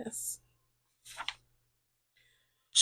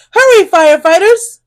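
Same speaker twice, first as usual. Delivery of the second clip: urgent call; 5 semitones higher, 7 dB louder than usual. A narrator's reading. Urging firefighters on.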